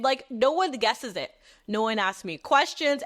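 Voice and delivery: normal talking voice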